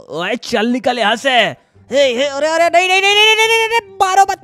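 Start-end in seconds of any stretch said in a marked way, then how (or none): 2.9-4.4 high, rapid string of protests; panicked pleading. Real, not acted. Player begging attackers not to kill him, panicked pleading. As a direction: beg frantically with pitch raised.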